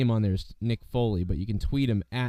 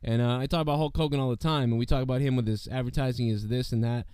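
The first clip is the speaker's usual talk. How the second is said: ordinary delivery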